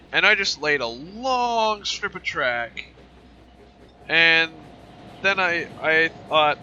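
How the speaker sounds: projecting over a roaring engine; talking over loud engines. Real, not acted. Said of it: "You probably can't even hear me right now. These engines are loud".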